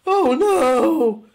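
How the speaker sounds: high, shocked "oh no"; mock dismay. Reviewer recoiling from a bad cartoon dog voice, mock horror. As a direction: squeal it in fake horror.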